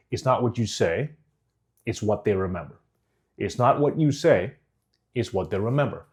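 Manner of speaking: measured phrases with a pause between; deliberate pauses. Shown as a demonstration. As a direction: pause and let each phrase land. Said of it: "and then continue".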